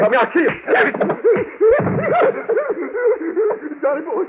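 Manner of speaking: put-on high, shrieking drunken laugh; high manic laugh, words spilling fast; manic laughter. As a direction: cackle wildly with the gun in hand. Drunk man waving gun.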